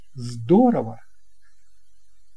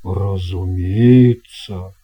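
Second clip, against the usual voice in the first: lower, slow, grand sea captain; captain's voice; 5 semitones lower, 5 dB louder, slower than usual. Acted. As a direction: slowly talk up the best cabin with a wink. Captain replies. (неторопливый низкий голос капитана)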